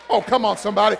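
ordinary talk

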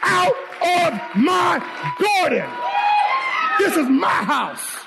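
slow, high-pitched order, word by word; hammered-out, high, indignant command; commanding shout. Acted. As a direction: throw the intruder out, drawing out each word. Acted husband ordering serpent out.

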